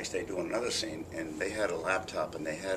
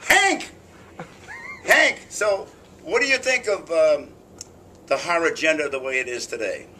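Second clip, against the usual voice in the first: loud, high showman host voice; host calling out to a guest; talk show host; 7 semitones higher, 6 dB louder than usual. Pretend.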